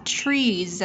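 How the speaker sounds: TREES ending in a buzzed Z; final Z sound. Shown as a demonstration. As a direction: finish "trees" on a clear Z. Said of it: "Z ending sound".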